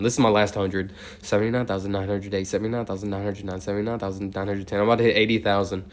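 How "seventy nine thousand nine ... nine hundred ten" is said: steady, rhythmic number chant; monotone counting. Real, not acted. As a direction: count on evenly in an unchanging tone, softer than chat. Counts aloud toward eighty thousand.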